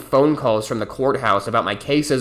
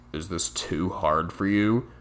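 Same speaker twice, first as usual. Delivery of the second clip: lower, softer, sneering question; condescending; 7 semitones lower, 8 dB quieter, slower than usual. Retold or imitated. Coworker mocking him over the onions, low condescending jab. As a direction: talk down to him. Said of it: "said condescendingly".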